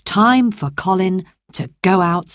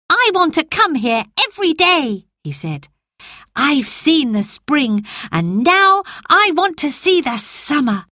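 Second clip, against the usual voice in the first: put-on boy Colin; 9 semitones higher than usual. Colin speaks, high boyish voice.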